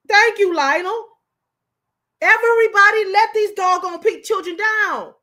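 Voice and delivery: loud, high indignation; pissed-off outburst. Real, not acted. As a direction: raise your voice in angry exasperation. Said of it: "I done got pissed off".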